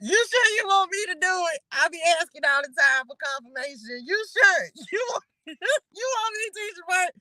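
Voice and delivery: high, laughing "You sure?" to the Lord; laughing high plea. Real, not acted. Host laughing at God over the hard name, high and giggly. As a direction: giggle through the disbelief.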